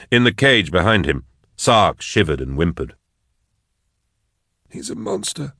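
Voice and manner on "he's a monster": high, soft mumble for Blake; mumbled. A narrator's reading. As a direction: mumble it under the breath, shaken. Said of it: "mumbled Blake".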